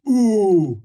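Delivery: high, loud startled ooh; the guard jumps at a noise; guard on the wall hearing a noise, startled; surprised guard. Acted. Guard reacts to a sound.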